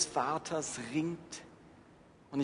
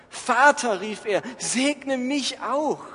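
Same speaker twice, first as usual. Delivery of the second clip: „Vater“ laut und hoch gerufen, „segne mich auch“ gedehnt; flehender Esau; 8 semitones higher, 6 dB louder, slower than usual. Acted. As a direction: verzweifelt um den Segen betteln. Esau begs father, first reading. (Esau fleht seinen Vater laut und gedehnt um Segen an)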